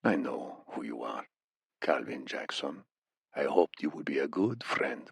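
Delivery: put-on low, soft accented menace; thick Iranian accent, hushed and threatening; Iranian captor. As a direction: quietly reveal what you know to the beaten prisoner.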